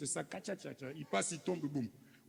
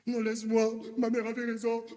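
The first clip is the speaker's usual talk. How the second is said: higher-pitched, louder mock wife brushing him off; annoyed wife; 6 semitones higher, 4 dB louder than usual. Wife rejecting her husband's sweet talk, higher, exasperated. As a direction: play the fed-up wife refusing the flattery.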